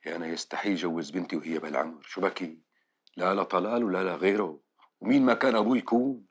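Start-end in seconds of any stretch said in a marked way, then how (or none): 3.2-6.2 loud, high furious refusal; angry. Acted. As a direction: shout it down, no way. Father refusing daughter's marriage heatedly. (رفض غاضب بصوت عالٍ)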